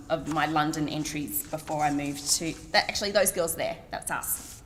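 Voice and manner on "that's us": ordinary talk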